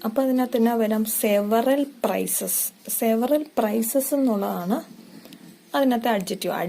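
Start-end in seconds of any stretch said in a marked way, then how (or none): 1.0-4.1 very slow, stretched phrase; very slow dictation. Shown as a demonstration. Teacher pointing out the adjective to students, very slow. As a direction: draw out both words.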